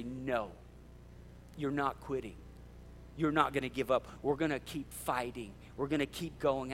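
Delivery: calm them down and hold them up; gentle reassurance. Acted. A friend telling someone on the edge of giving up not to quit, soft and reassuring.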